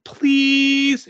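very high, drawn-out plea; imploring. A narrator's reading. Girl imploring the helper to open the drawer, imploring. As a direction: stretch it into a child's high whine of begging. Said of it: "implores Mary".

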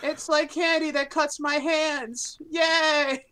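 high, slow cartoon unicorn voice; a lightly voiced cartoon unicorn; Charlie the Unicorn. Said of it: "Were you doing that voice?".

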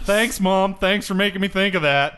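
loud, sarcastic thanks; loud teasing call-out; shouting a joking thank-you across the table; mock loud complaint. Real, not acted.

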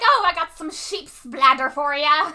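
loud, slow mock Irish brogue; hammed-up Irish lilt; Irish accent. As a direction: imitate an Irish mom offering food. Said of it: "she's talking in an Irish accent".

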